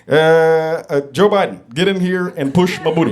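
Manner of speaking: lightly voiced Obama with halting uhs; a hint of Obama; Obama impression. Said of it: "Obama. He'd be like".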